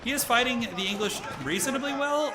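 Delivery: usual speaking voice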